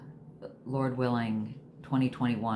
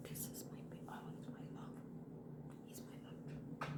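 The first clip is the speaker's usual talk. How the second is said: soft whispered pet talk, much softer; whispered cooing; 21 dB quieter than usual. Real, not acted. Showing her dog to viewers, whispered cooing. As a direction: whisper sweet nothings to a dog.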